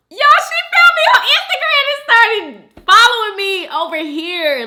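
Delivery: very high, loud delight; excited squeal. Real, not acted. Host telling viewers a follower found her, excited. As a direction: squeal the news to your viewers.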